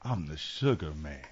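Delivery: low-pitched cool nickname catchphrase. Acted. Robinson using his nickname on everybody, deep voice. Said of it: "Like his voice got deeper".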